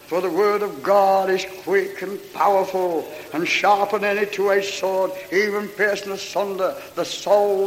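measured preacher's reading of scripture. Acted. Preacher reading Hebrews to the church, measured and solemn. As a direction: read the verse out to the congregation.

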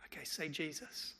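soft unvoiced whisper; hushed prompt. Pastor coaxing a mute boy to speak, whispered. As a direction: breathe it gently to the boy.

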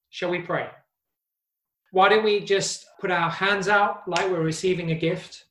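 ordinary talk, nothing marked

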